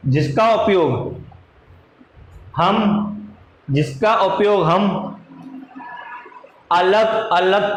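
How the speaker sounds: slow, measured dictation in short chunks. Acted. Teacher dictating a definition for students to write down, slow dictation. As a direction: dictate phrase by phrase, leaving time to write.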